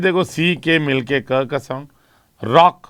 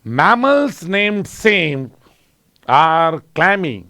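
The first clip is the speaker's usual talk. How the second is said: very slow, stretching each m; slow demo reading; much slower than usual. Shown as a demonstration. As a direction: drag out the words so the m sounds stand out. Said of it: "अब देखो". (बहुत धीरे, म की ध्वनि खींचकर)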